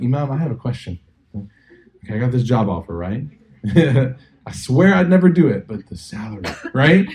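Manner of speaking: hammed-up question about a job offer; mock congregant. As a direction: play the tempted worshipper asking the imam. Mocking a congregant asking the imam.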